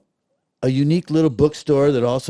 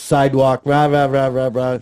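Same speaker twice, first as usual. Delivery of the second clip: imitated distant cheering chatter; 2 semitones higher, 7 dB louder than usual. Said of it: "somebody goes".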